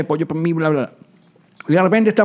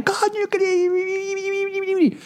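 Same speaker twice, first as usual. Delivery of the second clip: very high, drawn-out mock whine, softer; exaggerated wail; 6 dB quieter, much slower than usual. Host imitating Karen's regret over the concert, wailing. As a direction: squeal it out as a long imitated lament.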